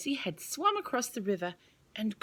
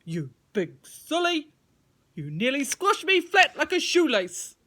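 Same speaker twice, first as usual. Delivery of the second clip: grumpy worm; 6 semitones higher, 6 dB louder than usual. Acted. Worm scolding Tim.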